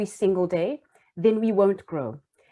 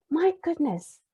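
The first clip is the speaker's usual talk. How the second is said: put-on higher, breathy wonder at the boy; an amazed inner thought, higher up; amazed awe; 9 semitones higher, slower than usual.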